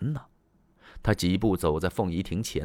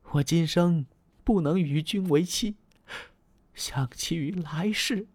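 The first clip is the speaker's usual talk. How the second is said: speaking while crying; 8 semitones higher than usual. Acted. Diao Chan clutches Lü Bu's hand, crying. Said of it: "一边哭一边说啊".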